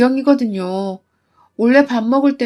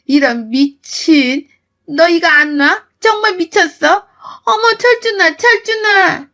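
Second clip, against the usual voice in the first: much higher, panicked cry for son; shrieking for help; 6 semitones higher, 3 dB louder, slower than usual. Acted. Mother-in-law shrieking for son. (머리채를 잡힌 시어머니가 아들을 부르며 높게 비명 지르듯)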